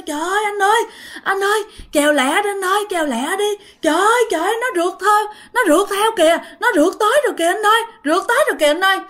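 high, frantic scream for help; panicked cry. Acted. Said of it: "bả mới la lên".

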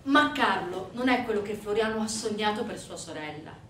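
ordinary delivery

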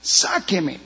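a drowning man pleading weakly as his strength goes; desperate plea, fading. Pretend. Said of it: "no diga desesperado".

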